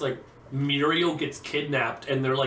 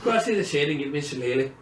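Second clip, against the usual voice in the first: mock Irish; faster than usual.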